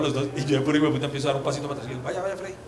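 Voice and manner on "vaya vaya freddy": mock quiet taunt. Mocking friend in broken English.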